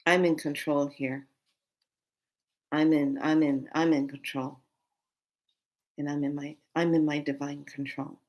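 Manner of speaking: low, measured, quiet mantra; steady yourself with spaced-out phrases; soft self-soothing, retold; calm self-control. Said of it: "And then I slip into this".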